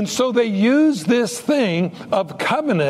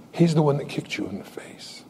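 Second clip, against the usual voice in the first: whisper; 3 semitones lower, 5 dB quieter than usual. Retold or imitated. Dramatic reveal to Joe.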